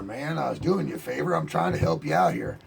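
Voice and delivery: ordinary delivery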